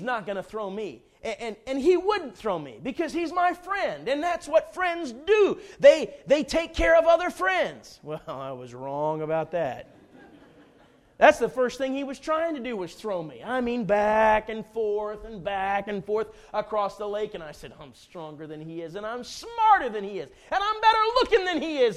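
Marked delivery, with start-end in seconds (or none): 1.2-8.0 higher, louder, overconfident protest; mock-earnest insistence. Retelling his misplaced trust in a friend, mock-earnest insistence. 17.8-22.0 building boast. Retold or imitated. Man on the tube sizing up his friend, building boast. Boasts while being towed.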